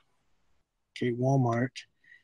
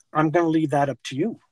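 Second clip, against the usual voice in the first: ordinary talk, nothing marked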